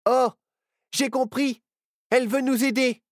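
high, loud call to grab on; excited call. Acted. Nao tells the others to hold on, excited loud call. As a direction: call out with sudden relief. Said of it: "cria Nao".

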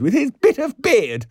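high, loud gleeful squeal; shrill, loud cry. A narrator's reading. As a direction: squeal it in mocking disgust. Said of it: "she cried".

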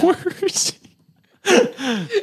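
drawn-out high wail; frantic parent. Retold or imitated. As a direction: stretch it out in a high panic. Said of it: "She plays Marlin from Nemo".

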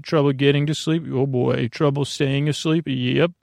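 ordinary talk, nothing marked

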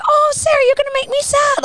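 very high, loud cutesy coaxing; imitated baby talk. Babysitter coaxing eight-year-old girl, exaggerated baby talk. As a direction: coo it as if to a baby, hammed up. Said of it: "I talked to her like kind of a baby".

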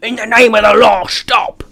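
shouted cartoon British bobby accent; British cop accent. British cop yelling at the tank to stop, hammy British accent. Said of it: "want to do the accent".

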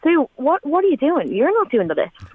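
hint of a kind friend refusing help; friend insisting kindly. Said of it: "It was like".

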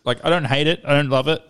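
usual speaking voice

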